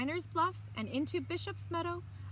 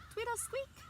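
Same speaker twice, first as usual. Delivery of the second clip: high squeaky wheel sound; 12 semitones higher than usual. A narrator's reading. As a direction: squeak it out like an old bike.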